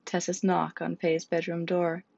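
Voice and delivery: ordinary delivery, nothing marked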